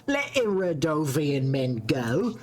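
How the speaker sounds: put-on a clumsy Scottish accent, scornful; bad Scottish accent. Hostile villager taunts the queen, bad Scottish accent. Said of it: "she's supposed to be Scottish".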